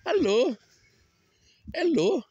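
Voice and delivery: high, loud hello call. Real, not acted. Walker hails the animals, high loud call.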